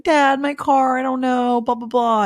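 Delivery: put-on calling dad like a helpless kid; whiny daughter. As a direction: whine to daddy for help. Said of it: "I'll just call my dad".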